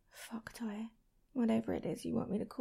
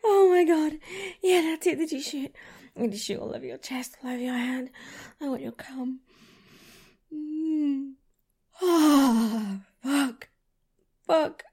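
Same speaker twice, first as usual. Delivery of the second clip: louder, breathless gasps easing down; afterglow moans; 3 semitones higher, 7 dB louder than usual. Acted. Lover catching breath after climax, breathless moans. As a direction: gasp and swear, spent.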